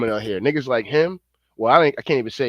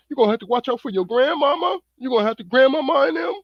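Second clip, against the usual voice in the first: mocking propaganda voice; 10 semitones higher than usual. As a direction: ham up a fearmongering TV pundit, pitched way up. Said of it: "trying to rally folks up".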